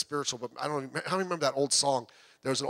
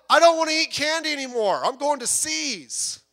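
higher, louder mock-silly tempter voice; mock excuse; 12 semitones higher, 6 dB louder than usual. Pastor mocking a foolish excuse to the congregation, higher and louder. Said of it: "that's like saying".

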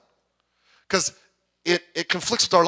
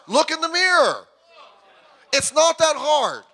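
high, forceful pulpit delivery; impassioned preaching; 7 semitones higher, 4 dB louder than usual. Real, not acted. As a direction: preach it loud and high, driving each point home.

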